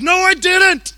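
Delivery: high, loud protest imitating a small child; indignant outcry. Three-year-old son denying it on dad's lap, indignant outcry. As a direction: shout back as a wrongly accused kid. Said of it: "cries out in indignation".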